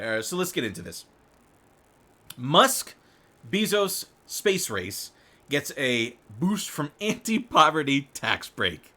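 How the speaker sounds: ordinary delivery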